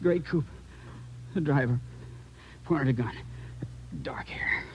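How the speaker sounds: whisper. Acted. Dying man fading.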